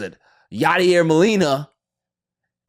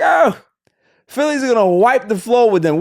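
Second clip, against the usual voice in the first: mocking doubters; 10 semitones higher, 7 dB louder than usual. Voicing doubting fans.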